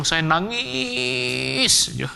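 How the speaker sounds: hugely elongated vowel in "nangis"; drawn-out crying word. Retold or imitated. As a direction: stretch "nangis" into a long mock wail.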